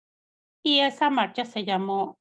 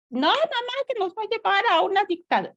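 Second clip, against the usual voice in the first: put-on much higher, fast warning voice of a grown child; imitating her kids; 12 semitones higher, 3 dB louder, faster than usual. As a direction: rush the warning in a high child voice. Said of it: "mis hijos me decían". (voz de hijo mucho más aguda y rápida, advirtiendo)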